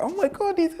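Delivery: very high, excited cry; imitating a mother who can't believe her ears; overjoyed mum. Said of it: "She's like".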